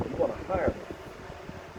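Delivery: soft, slow whispered sales pitch; hushed persuasive whisper; put-on voice. Shown as a demonstration. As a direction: breathe it like a smooth salesman, slowly. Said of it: "This is not how I usually talk".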